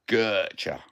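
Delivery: "gotcha" with a long drawn-out first syllable; Cockney word demo. Shown as a demonstration. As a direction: draw out "gotcha" slowly as GUR-cha. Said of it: "Shall we teach you some Cockney".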